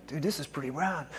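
soft, under-the-breath classroom remark; hushed aside. Retold or imitated. Class members looking around the room, hushed aside. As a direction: say it low-volume, as if to a neighbour.

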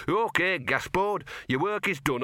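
high, grand dog herald; a lightly voiced solemn dog spirit; big dog voice. Gaspode imitates the death dog to Death, lightly voiced. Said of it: "big dog that says".

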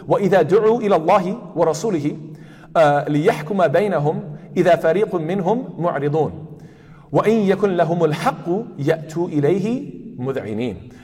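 fast melodic recitation of the ayat; quick tajweed chant; Quran recitation. Shown as a demonstration. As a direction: chant the verses in tajweed style. Recites Surah An-Nur.

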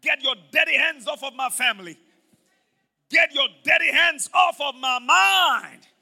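loud, slow rebuke of Satan; shouted declaration. Shown as a demonstration. Pastor leading the church in a shouted rebuke. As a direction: command the devil off, full voice. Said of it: "Say with me, everybody, say".

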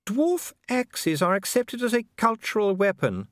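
high, slow genteel lady; a noble lady musing aloud; Lady Sybil. A narrator's reading.

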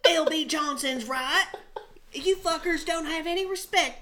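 mock patriot. Friend parodies Zac Efron's patriotic rant. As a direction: ham up a gung-ho soldier. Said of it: "Zac Efron being like".

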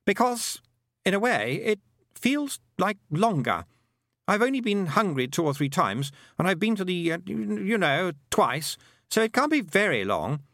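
put-on high boy's voice, halting and puzzled; boy character, puzzled. A narrator's reading. Roland reckoning time by meals, puzzled high boy.

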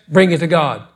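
ordinary talk, nothing marked

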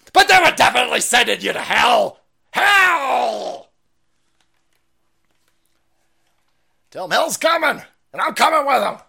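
loud, high hellfire shout; shouted threat. Acted. Threatening traffickers with hell in a loud shout. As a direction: roar it like a battle cry.

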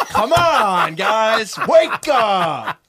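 loud, laughing exasperated yell; laughing shout. Real, not acted. Host laughing while yelling at historians to wake up.

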